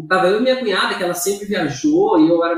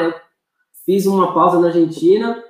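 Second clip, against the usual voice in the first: usual speaking voice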